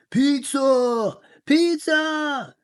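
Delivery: high, loud hungry chant; shouted pizza. Acted. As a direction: cry out for pizza like a starving herd. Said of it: "shouted the alpacas".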